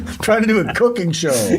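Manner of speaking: usual speaking voice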